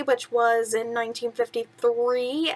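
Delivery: plain reading voice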